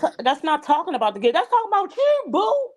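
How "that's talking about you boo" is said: sassy call-out. Real, not acted. Calling someone out.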